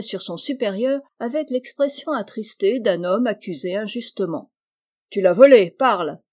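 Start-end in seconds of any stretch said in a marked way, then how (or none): 5.1-6.2 loud burst of accusing anger; angry accusation. A narrator's reading. Captain orders his orderly to confess the theft, furious. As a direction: bark it out to force a confession.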